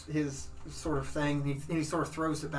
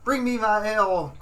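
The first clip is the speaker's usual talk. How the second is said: louder, higher lordly order; paladin demand; 7 semitones higher, 9 dB louder than usual. Acted. Paladin ordering ale at the tavern, lordly and loud. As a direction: call for ale like a lord. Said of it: "He says".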